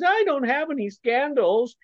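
mocking high-pitched politician voice; high-voiced Ron DeSantis. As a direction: put on a high voice to mock a harmless innocent. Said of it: "with that high convoluted voice".

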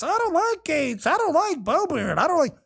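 mock whiny complaint. Mocking colleagues' petty complaints.

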